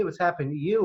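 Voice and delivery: ordinary talk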